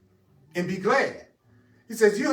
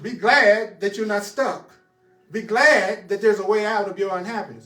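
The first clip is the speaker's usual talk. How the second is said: preaching emphasis; 3 semitones higher, 6 dB louder than usual. Real, not acted. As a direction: press each word on the listeners. Preacher exhorting congregation emphatically.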